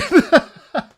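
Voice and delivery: short, loud, high cackle; burst of laughter. Real, not acted. Streamer apologising to viewers, loud burst of laughter. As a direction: crack up right after apologising.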